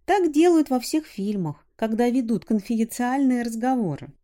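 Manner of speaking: put-on girl voice. A narrator's reading. Girl voice continues.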